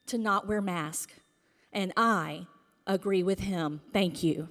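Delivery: normal talking voice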